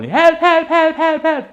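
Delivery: high, loud yelling for help; crying for help. Retold or imitated. Carl in the water yelling help to the yacht crew. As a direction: holler help at the passing yacht. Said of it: "And he said".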